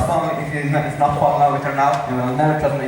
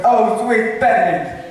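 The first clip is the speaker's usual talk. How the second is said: mock cry; 7 semitones higher, slower than usual. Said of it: "cries, curses".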